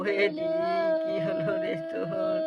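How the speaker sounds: weeping sister. Acted. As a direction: cry out to the brother-in-law in shock. Sister cries to brother-in-law. (জামাইবাবুর কাছে উঁচু গলায় কেঁদে ওঠা)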